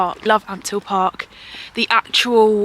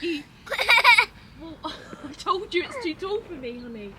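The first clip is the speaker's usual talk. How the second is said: much higher, soft and far from the mic, praising; 11 semitones higher, 8 dB quieter than usual. Real, not acted. Mum coaching toddler, laughing.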